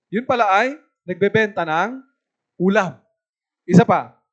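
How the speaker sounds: ordinary delivery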